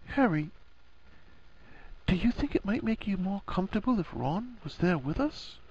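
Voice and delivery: high, hushed woman's voice; Hermione, careful suggestion. A narrator's reading. Hermione cautiously making Harry an offer, tentative. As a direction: float the idea softly and delicately.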